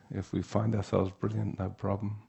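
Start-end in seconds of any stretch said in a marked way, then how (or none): none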